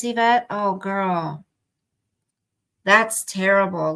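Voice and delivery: ordinary talk, nothing marked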